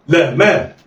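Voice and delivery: "لا ما" joined in one quick go; snatch "لا ما" with no pause; snatched together. Shown as a demonstration. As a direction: blend "لا ما" like one word. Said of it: "دي طريقة الخطف".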